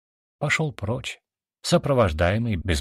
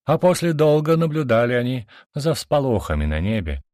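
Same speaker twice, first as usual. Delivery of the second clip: usual speaking voice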